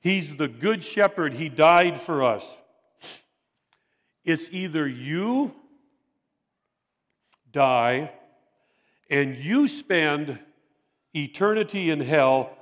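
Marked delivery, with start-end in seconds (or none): 4.2-12.5 slow grave warning. Real, not acted. Solemn warning of hell.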